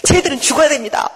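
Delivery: high-pitched vengeful insistence; mock angry demand. Jonah tells God his enemies must die, angrily insisting. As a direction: demand it of God in pretend fury. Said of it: "막 요나가 그래".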